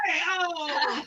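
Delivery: high mock Italian American shout; cartoon Italian American gangsters calling out; hammed-up Italian American exclamation; crappy Italian American accent. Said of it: "kobolds and going".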